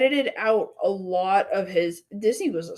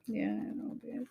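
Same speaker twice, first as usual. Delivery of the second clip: soft tender whisper; whispered comfort; 11 semitones lower, 12 dB quieter than usual. Real, not acted. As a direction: breathe it gently to comfort her. Comforting partner about friend.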